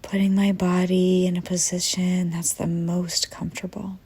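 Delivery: soothing first-person visualization lead; guided meditation. Acted. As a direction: guide them calmly into the breathing, unhurried. Leads breathing visualization.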